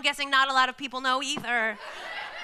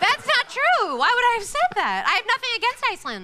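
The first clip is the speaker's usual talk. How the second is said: pitched-up defensive denial; protest, higher and quicker; high indignant denial; 6 semitones higher than usual. Pretend. Comic denial.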